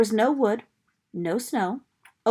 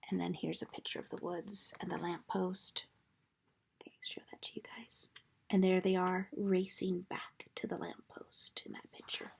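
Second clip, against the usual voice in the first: soft whisper to the listeners; whisper, showing the picture; whispered aside; 2 semitones lower, 13 dB quieter than usual. Real, not acted. Reader whispering to children about the picture.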